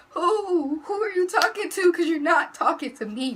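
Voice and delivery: high, loud, incredulous outburst; indignant retort. Retold or imitated. Teen rebuffing a man's offer of money, loud high indignation.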